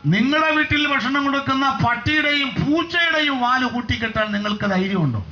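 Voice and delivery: high-pitched preacher's challenge; impassioned preaching. Real, not acted. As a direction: preach it high, driving through long phrases.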